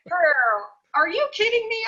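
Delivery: imitating a friend bowled over; amazed exclamation. Friend raving about the vegan food, high loud amazement. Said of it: "She said".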